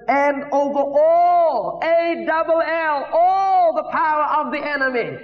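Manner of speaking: high, drawn-out preacher's cry; excited screaming. Retold or imitated. Preacher reliving the verse he read, screaming with excitement. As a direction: proclaim it high and slow, stretching the words. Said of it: "I was screaming by then".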